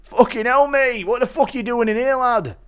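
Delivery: high-pitched mock British lad; Bully Tom. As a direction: imitate a startled lad walking in. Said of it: "Impression of Bully Tom walking in on Conor".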